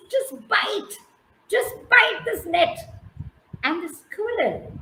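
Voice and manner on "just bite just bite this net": frog urging. Acted. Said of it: "He says".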